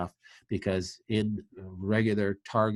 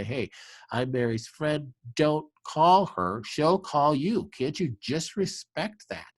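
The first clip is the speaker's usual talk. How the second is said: firm advocate; 5 semitones higher than usual. Pretend. Friend answering the clinic's call for Mary, firm and insistent. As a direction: put your foot down for your friend, slowly and pitched up.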